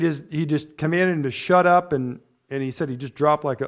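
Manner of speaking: ordinary talk, nothing marked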